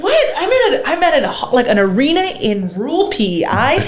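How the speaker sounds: high, laughing disbelief; amazed disbelief. Retold or imitated. Arriving at the rural arena, laughing in high amazed disbelief. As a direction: sound stunned by the crowd. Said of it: "And you're like".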